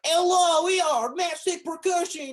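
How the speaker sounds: very high, loud, fake Eastern European accent; over-the-top mock Eastern European announcer; atrocious Eastern European accent. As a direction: yell a welcome in a hammed-up Eastern European accent. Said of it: "I'll slip into that voice sometimes".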